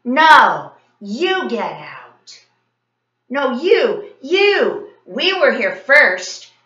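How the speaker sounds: put-on loud, high childish squabble; quarrel. A narrator's reading. Boys quarrelling over who owns the park, loud. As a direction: bicker back hard, no backing down.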